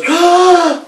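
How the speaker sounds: high, sharp shocked gasp; the witch's high startled gasp. Acted. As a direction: gasp as if caught out. Witch reacts, punchline.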